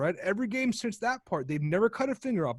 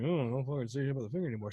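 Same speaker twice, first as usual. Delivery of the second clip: cowed mumble; 6 semitones lower, 7 dB quieter, faster than usual. Retold or imitated. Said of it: "everyone's like".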